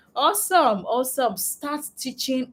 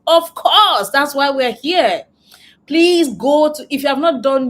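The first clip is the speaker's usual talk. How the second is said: loud, higher, eager of course; enthusiastic; 5 semitones higher, 8 dB louder than usual. Real, not acted. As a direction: cheer them on. Eager answer to chat question.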